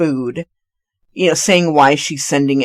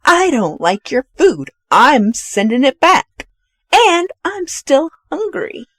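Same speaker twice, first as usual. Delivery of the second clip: higher, deliberate defiance; retold defiant complaint; 9 semitones higher than usual. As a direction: stand up and announce it, mock-bold. Retold diner defying the chef.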